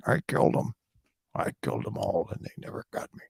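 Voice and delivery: breathy, fading confession whispered to himself; creepy whisper. Retold or imitated. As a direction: hiss it out like a secret killer. Killer's whispered confession on mic.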